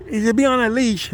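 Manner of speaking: put-on high, scolding old-lady squeak; mimicking old lady. Quoting the lady's leash lecture in a high scolding voice. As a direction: raise the voice high, nagging. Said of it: "some old ass lady was like".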